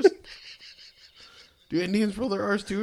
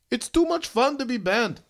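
put-on Indian accent; 8 semitones higher than usual. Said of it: "sent a proposal ... stating".

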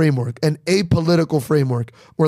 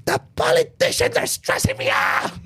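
high, strained mock panic; mock stressed-out whine. Comic mocking a politics-obsessed parent, high mock panic. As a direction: parody someone frazzled by politics.

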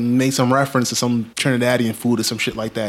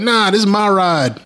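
higher, slow, smug brush-off; lightly voiced cocky friend, higher and slow; mock dismissive refusal; 7 semitones higher than usual. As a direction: turn him down slowly with a smirk. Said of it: "He's like".

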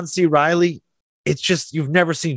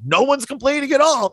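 ordinary talk, nothing marked

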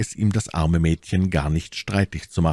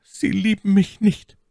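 much higher, slow stammered maid's voice; stammering maid; 3 dB louder than usual. A narrator's reading. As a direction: stumble through it as the hurt maid. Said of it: "stammelte Cathy". (hohe, langsam gestammelte Stimme der Zofe)

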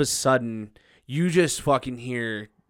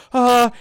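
loud, high "ah"; mock panicked cry; 11 semitones higher, 9 dB louder than usual. Reluctant server facing a bar fight, mock panicked cry. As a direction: re-enact a helpless cry of alarm.

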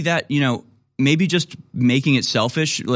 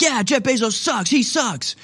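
much higher, gleeful mock cheer; eager agreement; 11 semitones higher than usual. Friends at a party cheering on a Bezos jab, gleeful high agreement. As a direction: cheer along like the whole crowd agrees.

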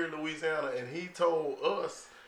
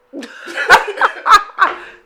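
high, loud cackling laugh; loud burst of laughter; 16 dB louder than usual. Real, not acted. Cracking up over the grandfather's tall tale, a loud high laugh. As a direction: let the laugh explode.

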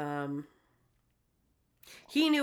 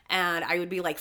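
normal talking voice

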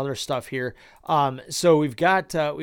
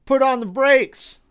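mock alarm; 10 semitones higher, 5 dB louder than usual. Mocking sudden reversal.

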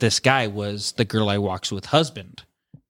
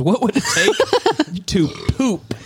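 laughing question; 6 semitones higher, much slower than usual. Real, not acted.

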